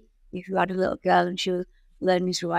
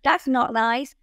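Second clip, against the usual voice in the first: high, slow mock-shocked voice, pitched well up; a lightly voiced gasping critic; 6 semitones higher, slower than usual. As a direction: play the shocked onlooker, hammed up. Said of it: "people think that's".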